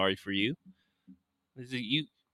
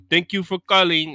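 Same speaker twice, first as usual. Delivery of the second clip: mock Indian call-centre accent; 7 semitones higher, 7 dB louder than usual. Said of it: "like, straight accents".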